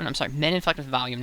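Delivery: ordinary talk, nothing marked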